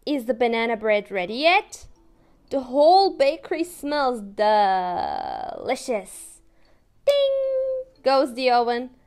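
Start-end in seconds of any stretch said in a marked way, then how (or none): none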